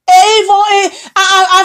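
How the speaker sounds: choking up mid-word from grief; crying. Real, not acted. Grieving woman breaks into tears over false pastors. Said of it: "je pleure".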